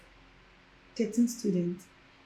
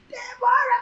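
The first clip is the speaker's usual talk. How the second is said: calling out. Retold or imitated. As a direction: shout her name across the yard. Classmates calling her name out.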